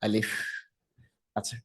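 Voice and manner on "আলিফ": আলিফ said simply and naturally, no strain; just say আলিফ in the ordinary way; আলিফ, natural Arabic letter sound; plain alif. Shown as a demonstration. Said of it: "আলিফটা just স্বাভাবিকভাবে উচ্চারণ করব".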